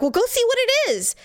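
high, urgent push to go check; shoving him out the door with your voice; urgent demand. Retold or imitated. Wife urging husband to check the noise, high and insistent.

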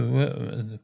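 ordinary talk

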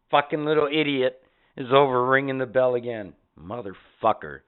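ordinary talk, nothing marked

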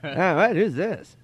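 baffled impression, high and slowed; Norm Macdonald. Comedian not recognising friend's text, imitated puzzlement. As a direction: imitate a clueless comic answering. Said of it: "he's done that to me every time".

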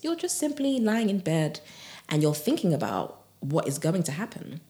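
ordinary talk

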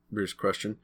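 ordinary delivery, nothing marked

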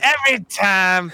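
gruff Chris Rock impression; slow, gruff comic drawl. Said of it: "But then he launched right in".

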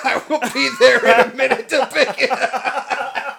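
high, loud and drawn out through laughter; slow, hammed-up robber cracking up; laughing mock robber. Co-host replays the robber's phone call for laughs, laughing mock robber.

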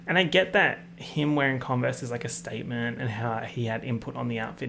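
normal talking voice

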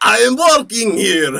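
put-on New York accent. Doing a New York bit.

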